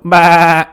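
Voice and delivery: very loud, high-pitched bleat; mocking sheep bleat. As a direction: blast a jeering "baa" at them. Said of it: "he continues to go".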